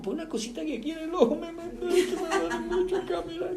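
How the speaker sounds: very high, soft, squeaky excuse; hammed-up mum hiding her tears; mock tearful mother. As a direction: make a feeble excuse in a tiny high voice. Mother pretending she is not crying.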